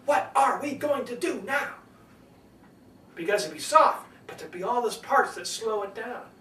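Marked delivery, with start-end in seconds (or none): 0.0-1.7 jerky, higher, deliberate word-by-word delivery; William Shatner. Retold or imitated. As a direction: jerk the sentence forward word by word. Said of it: "jerk forward like this".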